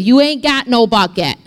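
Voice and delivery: a touch of sass, casual; lightly sassy woman at the well; sassy retort. Retold or imitated. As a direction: toss it off with mild attitude. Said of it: "The woman said to him".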